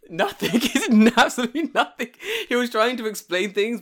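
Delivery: laughing while talking, high and loud; laughing through words. Real, not acted. Recalling his brother's stunt, laughing through it. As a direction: let the laugh spill into the words.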